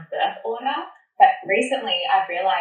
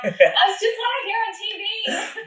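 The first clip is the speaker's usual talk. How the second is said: mock Valley girl; 12 semitones higher, slower than usual. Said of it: "You sound like a Valley girl".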